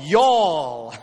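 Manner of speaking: a lightly put-on Southern drawl on y'all. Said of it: "all of you in Southern drawl".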